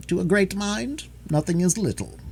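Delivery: high, measured maxim; sententious. A narrator's reading. Holmes lecturing the detective, mildly sententious. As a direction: hand it down as a little piece of wisdom. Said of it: "remarked Holmes sententiously".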